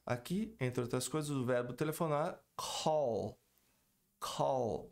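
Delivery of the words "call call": "call" with a clear final L; pronounced L. Shown as a demonstration. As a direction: end "call" with tongue on palate. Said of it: "e não call".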